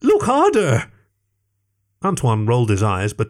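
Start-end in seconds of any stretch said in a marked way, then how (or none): 0.0-0.9 high desperate outburst; desperate shout. A narrator's reading. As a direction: cry out near tears. Greg desperate.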